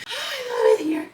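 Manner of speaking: very high, slow gasp of joy; gasping delight. Real, not acted. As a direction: gasp and swoon over it. Gasping fan excitement.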